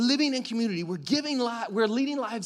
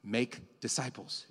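lower, softer and slow; quiet solemn quote; 6 semitones lower, 7 dB quieter, slower than usual. Shown as a demonstration. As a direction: let Jesus' words land softly. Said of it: "But Jesus said".

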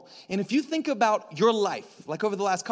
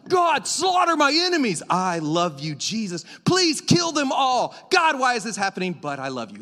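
David's bipolar prayer; 2 semitones higher, 5 dB louder than usual. Retold or imitated. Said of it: "he's like".

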